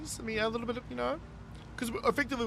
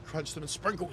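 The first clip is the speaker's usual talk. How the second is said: usual speaking voice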